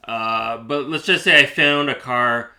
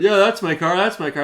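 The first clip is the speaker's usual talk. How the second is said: higher, insistent mimicry; mock insistence; 6 semitones higher, 4 dB louder than usual. Mimicking the seller keeping up the lie, higher. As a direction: parrot her stubborn lie. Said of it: "She kept the lie up".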